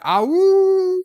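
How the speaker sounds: high, loud howl like a beagle; wolf howl. Pretend. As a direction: throw your head back and howl. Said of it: "I'm howling like a beagle".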